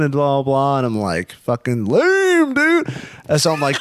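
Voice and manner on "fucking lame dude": high, slow sneering mockery; mocking put-on voice. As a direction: drag it out to mock him. Mocking the boyfriend's talk.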